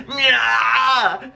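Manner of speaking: long, high "nyah" wail; hammed-up howl of pain; imitated pain yell. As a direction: yelp like your wrist is being bent. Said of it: "he's yelling and stuff".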